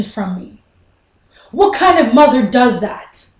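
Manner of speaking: loud, emphatic accusation; angry. Acted. Son accuses Mom of hiding important information, angry. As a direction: lay into the listener, indignantly. Son scolds mother in quarrel.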